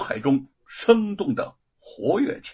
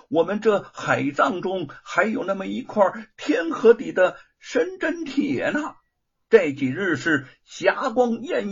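dragon lady; 7 semitones higher than usual. A narrator's reading. Dragon women advising the king about the iron, high urging. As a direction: plead in a raised female character voice.

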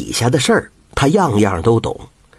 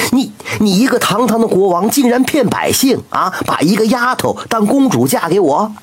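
indignant toad; 8 semitones higher than usual. A narrator's reading. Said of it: "于是蛤蟆急了".

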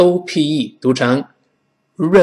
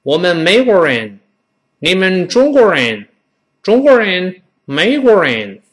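put-on foreigner's Chinese accent, higher, rising on 人; foreign accent; 6 semitones higher than usual. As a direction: imitate an American teacher's off Chinese. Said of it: "他常常这么说".

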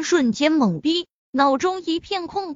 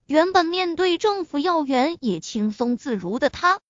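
ordinary delivery

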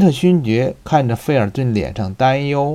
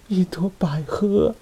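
breathless whisper; 7 semitones higher, 4 dB quieter than usual. Acted. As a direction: hiss the words out under her breath. Milady reveals the brand.

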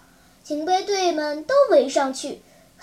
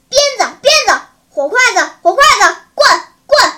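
frantic shouting; 5 semitones higher, 9 dB louder, much faster than usual. A narrator's reading. Humiliated captain yells at his men for whips and rods. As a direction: bellow much louder and higher, in bursts. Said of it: "连喊带叫".

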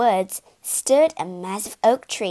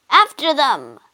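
loud order to chase; commanding cry; 4 semitones higher, 6 dB louder than usual. Acted. Aietes orders his army after the Argo, loud. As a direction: bark it at the army. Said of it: "cried Aietes".

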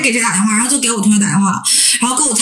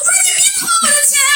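high, fast, sing-song wail; mock wailing; faster than usual. Crying to the scammer on the balcony, hammed-up wail. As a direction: wail it out like a heartbroken cry. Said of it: "撕心裂肺的，连哭带嚎的".

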